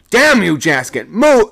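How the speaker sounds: very high, loud roar; a sailor roaring in fury at a shipmate; furious roar. A narrator's reading. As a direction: roar at him to move. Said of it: "I roared".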